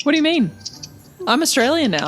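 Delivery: mock-Australian accent with lifted pitch; put-on Aussie accent. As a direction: play innocent in a fake Aussie accent. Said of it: "Your accent".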